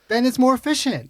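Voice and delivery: mocking. Mocking the efficiency argument.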